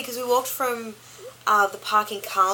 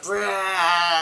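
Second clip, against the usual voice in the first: zombie. Retold or imitated. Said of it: "just screaming out".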